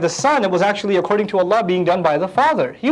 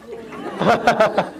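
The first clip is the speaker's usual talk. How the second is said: real laugh; 4 semitones higher than usual. Real, not acted. Laughing after translation.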